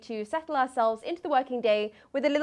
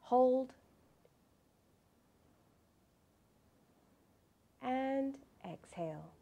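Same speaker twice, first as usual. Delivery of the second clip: calm meditative tone; 6 dB quieter than usual. Acted. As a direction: count the breaths gently and evenly. Leads a breathing meditation.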